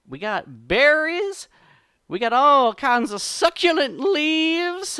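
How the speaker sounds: high, loud, hesitant fibber; flustered trickster in a high fake voice; put-on party voice. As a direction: stretch out each food temptingly. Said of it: "himself is doing these voices".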